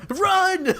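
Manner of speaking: high, sudden playful yell; mock panic shout. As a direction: shout the warning like in a horror movie. Mock alarm at stalker story.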